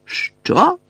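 sharp, very high burst; outraged what. A narrator's reading. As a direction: explode in disbelief. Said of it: "вибухнув Джеймс".

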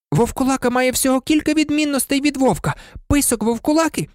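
very high-pitched pupil reciting the answer; high, insistent know-it-all; eager outburst. A narrator's reading. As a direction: rattle off the answer in a high girl's voice. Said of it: "крикнула Герміона".

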